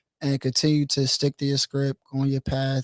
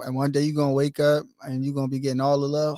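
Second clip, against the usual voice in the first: usual speaking voice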